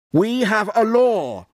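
high-pitched, insistent declaration; crying out. A narrator's reading. High priest pressing Pilate for death, crying out. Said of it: "cried the high priest".

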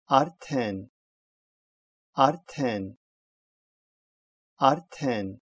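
"Артен" with d sounded as t after r; teacher's separate "Артен", t following the r; demonstrated pronunciation. Shown as a demonstration. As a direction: model "Артен" carefully with a released t. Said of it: "произносится «т» после согласных «р»".

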